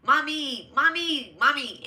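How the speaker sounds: put-on high, wailing child's voice calling for mommy; imitating a crying child. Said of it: "I could hear a child crying like".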